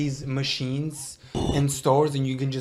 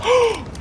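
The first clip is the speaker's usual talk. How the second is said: sharp high gasp; 3 dB louder than usual. Real, not acted. Reacting to the reveal.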